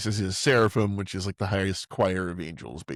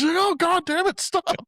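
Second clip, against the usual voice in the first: high-pitched, exasperated mock cry; mock panicked yell; slower than usual. Movie heroine fighting a fire in her room, mock panicked yell. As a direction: yelp it as if startled, in imitation.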